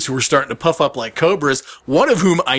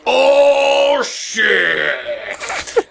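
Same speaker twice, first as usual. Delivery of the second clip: crowd reaction; 9 semitones higher than usual. Retold or imitated. Entire crowd reacts to the comedian's comeback, drawn-out mock uproar. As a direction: imitate a whole crowd reacting to a burn. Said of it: "the entire crowd goes".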